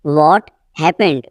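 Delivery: teacher's slow split before "happened"; slow repeat. Shown as a demonstration. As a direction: pause after "What". Teacher repeats sentence slowly.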